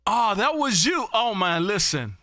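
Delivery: usual speaking voice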